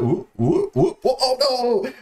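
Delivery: wobbly high whoops into a drawn-out oh no; mock balancing whoops. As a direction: whoop like something is about to topple. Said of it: "balancing on it".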